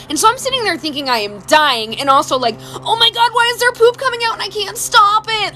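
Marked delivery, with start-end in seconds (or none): 2.8-5.5 high-pitched comic alarm; mock panic. Woman retelling food poisoning on the toilet, mock panic. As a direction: wail the question high, hammed-up panic.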